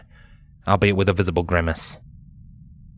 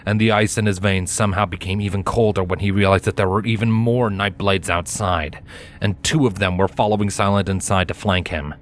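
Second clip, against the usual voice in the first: ordinary delivery, nothing marked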